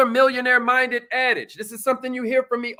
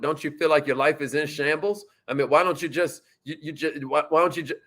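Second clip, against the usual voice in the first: lower, hesitant, pushy stammering seller; pressure sales pitch; 6 semitones lower, 4 dB quieter than usual. Shown as a demonstration. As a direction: stammer and push like a desperate salesman. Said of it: "here's how I sound when I'm putting pressure on a prospect".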